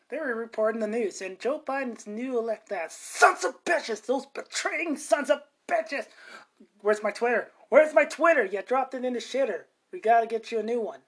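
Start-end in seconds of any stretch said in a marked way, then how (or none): 2.7-6.0 slow bitter cursing. Acted. Boss cursing Fox News. 6.8-11.0 frantic boss. Acted. Boss and aide skit.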